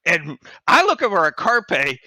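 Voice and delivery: ordinary talk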